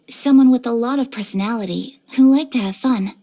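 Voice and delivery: normal talking voice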